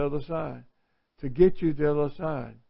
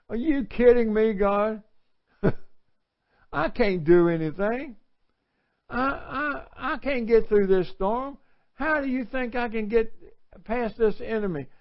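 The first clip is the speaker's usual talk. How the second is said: high, disbelieving complaint to God; pitched-up incredulous whine; incredulous Gideon; 6 semitones higher than usual. Acted. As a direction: protest to God, pitched up, half laughing. Voicing Gideon's doubt.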